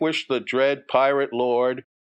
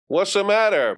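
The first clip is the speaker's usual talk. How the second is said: lightly voiced bully, pitched up; a hint of a schoolyard bully, higher; taunting bully; 8 semitones higher, 3 dB louder than usual. Bully confronts Johnny on the walk home, lightly taunting.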